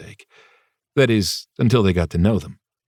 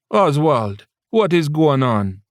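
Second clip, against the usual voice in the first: put-on high, slow lyrical Jamaican accent; a lightly voiced Jamaican mother; 7 semitones higher, slower than usual. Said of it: "her lyrical Jamaican accent".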